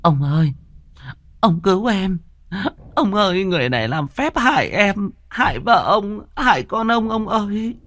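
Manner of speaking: high, desperate wife crying out to her husband; a cornered wife shrieking for her husband's help; wailing plea for help. Acted. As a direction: wail high and pleading, begging him to save her. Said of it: "bà Tư liền kêu gào cầu cứu".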